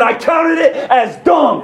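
high, drawn-out preacher's declaration; full-force preaching. Acted. Preacher quoting Paul rejecting his old religion, impassioned preaching.

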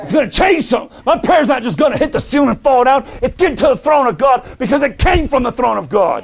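high, urgent declaration of faith; fired-up pulpit shout; full-force preaching. Acted. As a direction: cry it out with total conviction.

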